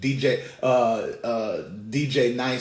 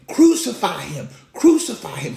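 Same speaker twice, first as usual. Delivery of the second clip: loud, raised cry for execution; mock crowd screaming; 5 semitones higher, 8 dB louder than usual. Hostile mob demanding Jesus's death, acted loud yelling. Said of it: "They are literally screaming".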